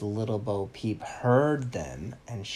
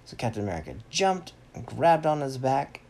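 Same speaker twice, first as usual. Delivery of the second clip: ordinary delivery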